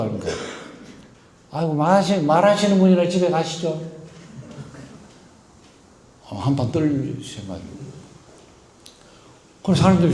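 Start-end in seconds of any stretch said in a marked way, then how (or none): 1.5-3.8 retort. Retold or imitated. Mother snapping back at patron.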